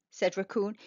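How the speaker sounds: usual speaking voice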